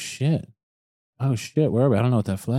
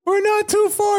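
excited. Real, not acted. Repeating the close guess.

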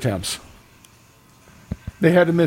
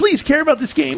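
high-pitched mock begging; mock pleading; 10 semitones higher than usual. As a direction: beg in a hammed-up high voice. Voices broadcast begging viewers to care.

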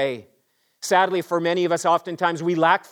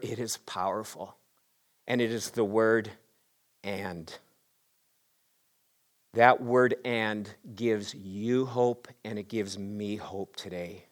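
hushed emphasis; 6 semitones lower, 7 dB quieter than usual. Real, not acted.

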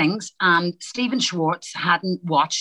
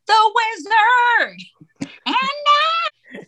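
loud, high, drawn-out American belting; American accent; 4 dB louder, much slower than usual. Retold or imitated. Irish performer still slipping into American in rehearsal, exaggerated American belt. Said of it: "still trying to come down from an American accent".

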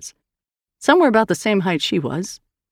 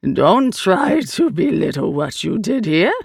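slow alien voice; strange creature, rebuking; slower than usual. Acted. The Eye rebukes Hand for its deeds, slow creature voice. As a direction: rebuke slowly as an otherworldly being.

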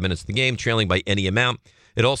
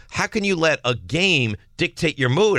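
retold scolding; 7 semitones higher than usual. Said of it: "I go".

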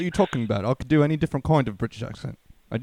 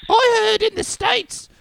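a silly upper-class Brit, pitched way up; posh British accent; 5 dB louder than usual. Pretend. Mocking the UK to his friends in a posh British accent. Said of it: "this is, like, a more posh".

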